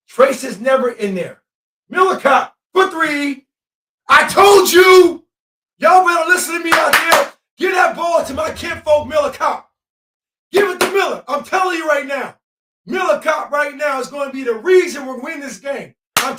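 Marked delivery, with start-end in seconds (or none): 1.9-5.2 much higher, louder, stretched out slow; triumphant yelling, I told you; ecstatic shout. Real, not acted. Celebrating a made three live. 5.8-13.7 high and loud, bragging; fired-up fan shouting; hyped shouting. Real, not acted. Hyped after the three.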